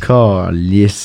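low, drawn-out CALICE with a lingering final S; hot hissed S. Retold or imitated. Swearing into a Frenchman's ear, low CALICE with a hot, lingering S. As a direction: stretch CALICE slowly into a sultry "sss" ending. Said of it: "Calice, avec le S un peu chaud".

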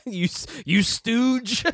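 mock insult. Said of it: "insulting the moviegoer".

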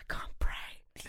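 soft slow whisper; whispering to dad secretively. Retold or imitated. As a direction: breathe it like a secret.

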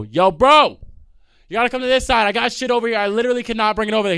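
high, loud call to the driver; yelling to driver across the street. Retold or imitated. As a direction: shout it across the road.

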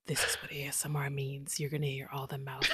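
unvoiced whisper parodying an ASMR influencer; mock ASMR whisperer. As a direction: lampoon a breathy ASMR video. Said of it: "social media just basically bastardized".

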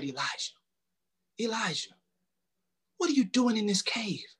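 hushed, whispered voice of God; calm still voice. A narrator's reading. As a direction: softly call his name, barely a breath. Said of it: "in a calm, still voice".

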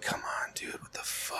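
unvoiced hushed disbelief; whispered come on dude. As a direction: breathe it out under your breath. Quiet exasperated whisper quote.

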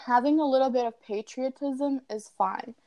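ordinary talk

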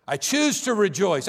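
ordinary talk, nothing marked

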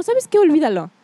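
high-pitched mimicked brush-off; dismissive teacher. Girl quotes the teacher sending her back, mock dismissive. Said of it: "me dijo así como".

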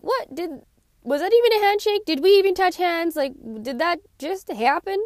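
high, mock-baffled what-was-that; incredulous disbelief. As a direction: pitch jumps up in staged amazement at a limp handshake. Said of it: "you're like".

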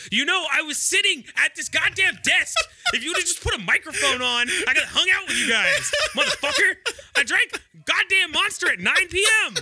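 high-pitched, half-laughing complaint; exasperated rant. Real, not acted. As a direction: gripe at friends in comic indignation. Mock rant at friends for excluding him.

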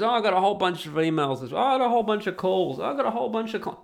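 mocking blogger. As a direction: parody a blogger bragging about emails. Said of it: "when a blogger says".

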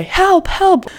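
high-pitched "help" at normal volume; mock cry for help. Shown as a demonstration. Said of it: "or if you say".

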